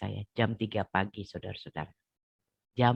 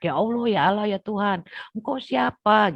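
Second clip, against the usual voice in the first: high desperate wail, pushed loud; crying out to God; 10 semitones higher, 5 dB louder than usual. Retold or imitated. Sermon retelling her desperate night cry to God, high anguished wail. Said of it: "Akhirnya saya teriak-teriak".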